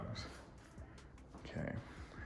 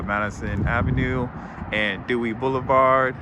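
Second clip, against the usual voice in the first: usual speaking voice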